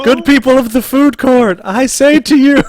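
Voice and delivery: booming mock town-crier address; mock orator. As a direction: parody a hero addressing the crowd at high volume. GM parodies Thrognar's speech to crowd.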